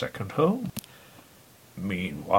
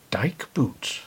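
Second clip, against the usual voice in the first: normal talking voice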